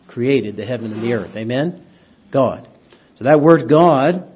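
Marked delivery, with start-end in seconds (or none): none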